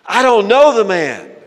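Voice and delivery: high, very loud, furious outburst; shouted denial. Acted. Peter cursing as he denies Jesus, shouted denial. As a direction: shout it to cut off all accusers.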